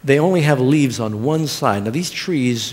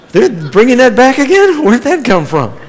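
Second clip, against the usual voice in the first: much higher, louder mock astonishment; baffled exclamation; 9 semitones higher, 9 dB louder than usual. Radio listener hearing an old show come back, baffled exclamation.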